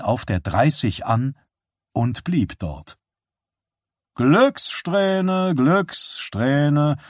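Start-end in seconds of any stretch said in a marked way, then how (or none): 4.1-7.0 high, drawn-out fluting call; resigned fluting. A narrator's reading. Showman to the small cheering crowd, resigned fluting. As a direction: flute it in a high singsong, resigned. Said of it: "flötete der Schausteller resigniert".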